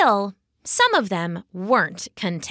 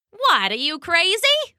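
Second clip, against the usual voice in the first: sassy frog woman; 9 semitones higher than usual. A narrator's reading. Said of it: "asked a warty woman".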